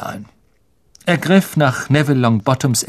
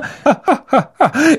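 high self-satisfied chuckle; smug laugh; 13 semitones higher than usual. Acted. Lockhart laughs smugly at Harry. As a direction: laugh at your own vanity.

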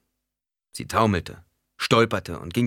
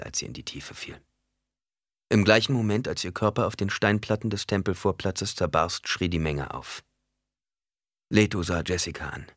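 plain reading voice

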